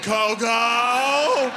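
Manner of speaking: loud, high, long-held name; exasperated shout. Retold or imitated. Keeper shouts at Coco to stop, exasperated. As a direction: yell it to cut her off, stretched out.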